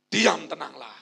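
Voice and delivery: firm demo for the crowd to repeat; firm command. Shown as a demonstration. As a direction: say it with faith for them to echo. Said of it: "Ngomong kayak gini".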